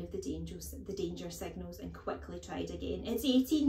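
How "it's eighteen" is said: put-on higher, louder panicky boy; cried out. A narrator's reading. Small boy sensing danger, crying out hastily. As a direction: blurt out a desperate guess. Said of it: "he cried".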